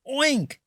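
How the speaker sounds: high oink; pig oink. A narrator's reading. Reading to children, a high pig oink. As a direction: squeal a pig's oink. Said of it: "said the hog".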